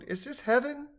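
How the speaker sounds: high, blissful wonder; moaning. A narrator's reading. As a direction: moan it as if overcome by delight. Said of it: "Cheeky moaned".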